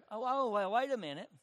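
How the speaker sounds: normal talking voice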